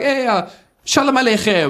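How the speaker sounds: high, put-on cheerful greeting; mock greeting. Comic telling his wife's family hello. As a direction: greet them like a polite outsider.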